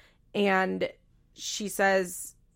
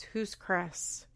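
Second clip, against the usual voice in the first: deadpan; 8 dB quieter than usual. Retold or imitated. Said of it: "Brittany is so funny. Without missing beat".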